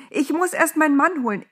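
high-pitched excited outburst; agitated call, easing off at the end; calling out urgently. A narrator's reading. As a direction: blurt it out high and insistent. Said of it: "rief sie dem Engel entgegen".

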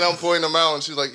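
ordinary talk

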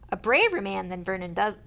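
plain reading voice

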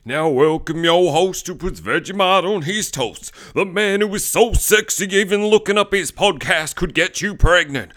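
loud, high hype announcer; hype man. Acted. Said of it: "Best hype man in town he is".

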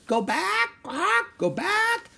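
piercingly high talking bird; parrot squawk. A narrator's reading. Parrot circling over pirates with a warning, squawking. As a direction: screech it like a parrot. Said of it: "she squawked".

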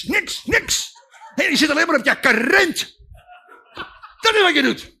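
loud, high furious tirade; ranting Martha. Acted. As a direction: vent every grievance at the lazy sister.

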